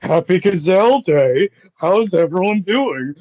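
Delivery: slurred, loud, slow goofy voice; drunk Will Ferrell. Acted. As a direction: play it tipsy and booming. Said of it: "his voice is like".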